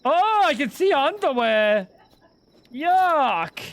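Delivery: high, loud disgusted voice; disgusted squeal. Acted. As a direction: squeal it out, grossed out. Reacting in disgust.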